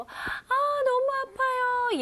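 acted scream of pain, very high; high, sharp cry. Teacher acting someone screaming in pain, high sharp cry. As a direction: wail it high as if hurting badly. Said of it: "아주 좀 높고 날카로운 소리로".

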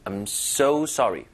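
"so" drawn out with extra force; stress on so. Shown as a demonstration. English teacher repeats the model for learners, "so" stressed. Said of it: "보다 힘을 살짝 주는 거예요".

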